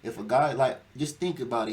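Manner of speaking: usual speaking voice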